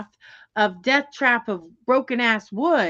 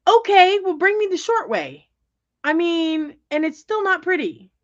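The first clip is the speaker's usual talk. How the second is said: high, louder fed-up "okay"; exasperated retort; 7 semitones higher, 4 dB louder than usual. Retold or imitated. Answering boyfriend about the famous pond, exasperated. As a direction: roll your eyes and snap back.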